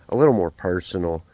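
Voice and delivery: ordinary delivery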